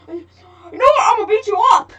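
ordinary talk, nothing marked

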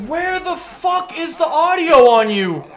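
exasperated shout. Real, not acted. Yelling about teammate's loud audio.

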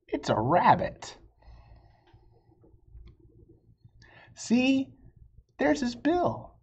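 lively, high storybook read-aloud; bright read-aloud with high character lines; reading to children. Acted. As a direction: voice the two arguing friends for kids. Reading picture book, two voices.

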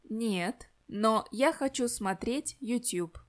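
normal talking voice

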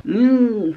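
high-pitched doubtful "hmm"; skeptical hum. Real, not acted. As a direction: hum high, clearly unconvinced. Doubts the influencer's stated motive.

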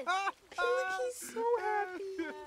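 high, drawn-out gushing; delighted. Real, not acted. Player seeing her Sim so happy, delighted squeal. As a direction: squeal it with a laugh in it.

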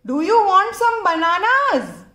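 high, loud cheerful seller; friendly vendor voice. Acted. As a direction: call out like a street vendor. Banana seller offers bananas.

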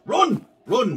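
high shout to run; urgent cry. Real, not acted. Gamer escaping the killer, shouting high to run. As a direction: yell it as you flee.

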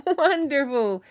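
high, loud cheer; shouted. Acted. Travelers cheering the potato, high and loud. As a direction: shout it with joy. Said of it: "shouted the travelers".

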